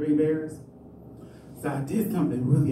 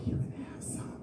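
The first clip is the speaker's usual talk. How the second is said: unvoiced sly whisper, much softer; whispered punchline; 12 dB quieter than usual. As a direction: whisper the offer slyly.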